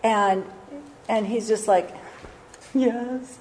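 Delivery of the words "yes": soft, higher-pitched mock reply; quiet comic yes, pitched up; hushed acceptance. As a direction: act a small, awestruck yes. Acting Boaz's reaction to the proposal.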